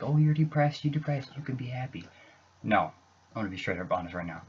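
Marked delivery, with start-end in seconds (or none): 0.0-2.1 high, loud mocking "oh you're depressed"; sing-song parody of people who brush it off; mocking others who say cheer up; mocking dismissive people. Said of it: "people are like".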